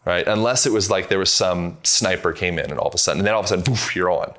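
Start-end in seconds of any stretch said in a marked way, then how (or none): 3.6-3.9 breathy little poof of air; imitated poof. Shown as a demonstration. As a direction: puff out a sudden poof. Imitates senses switching on.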